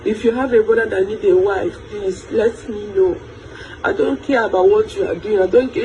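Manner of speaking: ordinary delivery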